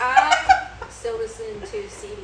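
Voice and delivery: high, loud and slow, laughing; hammed-up, giggly self-mocking brag; mock boast. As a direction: playfully show off through laughter. Self-mocking "not like other girls" boast.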